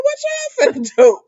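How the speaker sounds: high-pitched squealed mock question; teasing disbelief. Narrator teasing the couple about going to the house, teasing disbelief. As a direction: squeal it out, playfully scandalized. Reacting to characters heading to the house.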